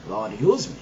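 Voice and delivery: ordinary talk, nothing marked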